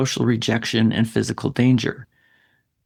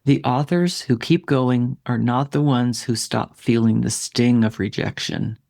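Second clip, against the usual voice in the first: slower, deliberate restatement; a bit slower. Shown as a demonstration. Coach repeating the key point to writers, a bit slower. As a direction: say it slowly and deliberately, letting each phrase land. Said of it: "say that again a bit slower".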